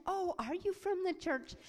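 acted eager welcome. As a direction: act out a mother greeting an expected visitor. Mother greets visitor at door.

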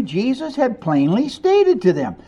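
ordinary delivery

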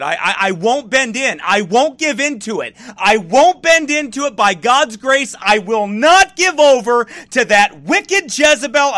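ordinary talk

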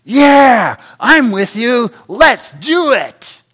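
high, loud and keen, the last words stretched slow; a passenger cheering the reckless driver on, eager and loud; eager agreement. Acted. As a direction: jump in with hammed-up enthusiasm.

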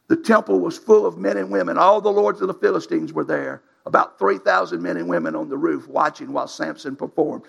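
ordinary talk, nothing marked

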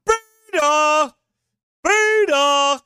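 high, loud, drawn-out mock holler; mocking shout. Preacher ridicules a leader asking women for help, mocking shout. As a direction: bellow the catchphrase in mockery. Mock confession using the clip's catchphrase.

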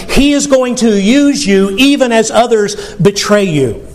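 normal talking voice

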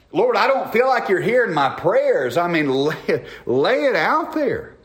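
high-pitched, stretched-out sermon exhortation; impassioned preaching. Real, not acted. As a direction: plead it out to the congregation, pitch held high. Preacher exhorts and models honest prayer.

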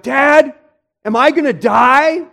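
high, loud mock-hysterical child; panicked kid. Child to dad over a tiny cut, mock panic. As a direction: imitate a kid freaking out over nothing. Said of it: "they're screaming, they're crying".

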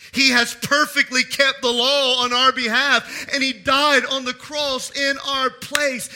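loud, slow, ringing pulpit delivery; full-force preaching. Acted. Preacher to congregation, loud and slow. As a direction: proclaim it to the whole church.

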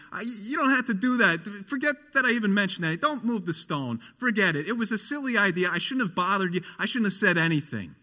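high, hurried, flustered voice; Martha backpedalling. Retold or imitated. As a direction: take it all back in a rush. Doubting Martha backpedals, voiced by preacher.

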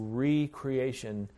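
"recreation" as REE-cree-AY-shun, slowly; slow "recreation" with a long separate REE; re-creation pronunciation. Shown as a demonstration. Preacher naming the theological term, re-creation pronunciation. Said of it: "we should pronounce it that way".

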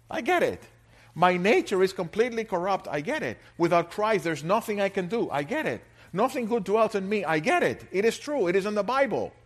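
loud, emphatic sermon voice, driving each point home; fiery preaching. Acted. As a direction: hammer the doctrine from the pulpit. Preaching at full force.

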